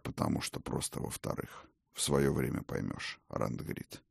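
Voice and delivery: soft, half-whispered evasion; hushed old king. Acted. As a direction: trail off almost to a whisper. Odin evades, hushed.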